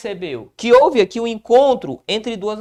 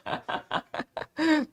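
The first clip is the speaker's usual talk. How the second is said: light laugh; 8 semitones higher, 8 dB quieter than usual. Real, not acted. Joking about a student.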